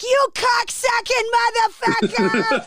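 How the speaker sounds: high, slow Boston-accented mock yell; hammed-up tipsy Boston cursing; drunken Boston accent. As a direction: let a thick Boston accent burst out. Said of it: "Two, three shots of tequila".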